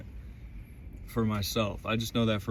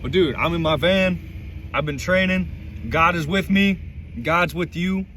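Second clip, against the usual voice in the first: higher, louder, emphatic pep talk; rally the listener with slow, driven emphasis; fired-up exhortation; 5 semitones higher, 4 dB louder, slower than usual. Real, not acted. Vlogger urging viewers on from the van, fired-up exhortation.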